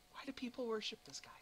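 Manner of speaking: whispered, baffled question; soft, incredulous whisper; hushed disbelief. Acted. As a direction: drop to a whisper, appalled. Acted aghast reaction to evil god.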